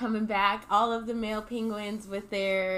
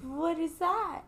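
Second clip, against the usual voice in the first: higher, slow bemused question; puzzled mother; 6 semitones higher, slower than usual. Retold or imitated. Mother Norma Jean puzzled at her chick's feet. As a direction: ask it baffled. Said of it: "She's like".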